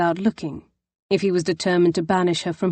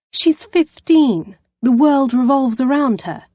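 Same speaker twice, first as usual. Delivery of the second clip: exasperated; 6 semitones higher, 5 dB louder than usual. Acted. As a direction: drag out the age in weary defence. Poppy defends teenage sister.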